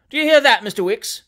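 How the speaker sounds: loud, high call to the clerk; calling to a witness. A narrator's reading. Lawyer calls clerk as witness.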